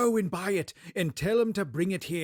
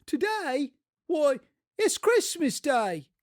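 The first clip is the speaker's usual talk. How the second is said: put-on boy's reply; 9 semitones higher than usual. The boy answers.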